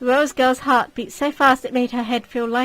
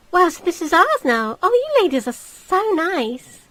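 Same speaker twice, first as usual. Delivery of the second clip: put-on cheeky girl; 7 semitones higher, 5 dB louder than usual. Bratty girl mocking the ladies.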